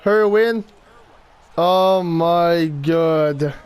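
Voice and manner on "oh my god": very slow, drawn-out disbelief; dismayed. Real, not acted. Player just scored on by Boston, dismayed. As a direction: stretch every word out in dismay. Reacting to goal against.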